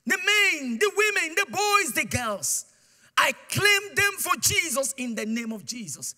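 high, loud preacher's proclamation; bold declaration. Retold or imitated. Tied to the tree, the preacher loudly claims the land for Jesus. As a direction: proclaim it over the land with full force. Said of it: "I started to declare".

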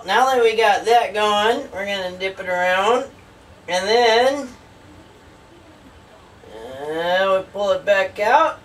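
ordinary talk

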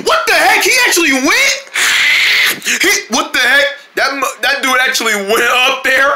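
high-pitched disbelief; astonished. Acted. Friend watches him fly to Mars, astonished. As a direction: squeal it in shocked amazement.

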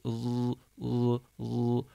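"l" drawn out with tongue on side teeth; held lateral l. Shown as a demonstration. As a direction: hold "l" against the side teeth. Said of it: "Böyle bir ses çıkartmamız lazım".

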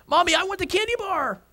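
put-on high-pitched child begging mom; mock whiny kid plea; whining little girl. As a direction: imitate a small child starting to cry. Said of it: "this little girl begins to cry".

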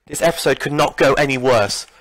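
ordinary talk

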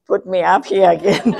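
loud, breaking into a laugh; amused, tipping over into loud laughter. Real, not acted. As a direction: say it through rising laughter. Joking about being put up again.

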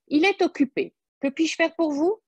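the secretary politely deflecting. A narrator's reading.

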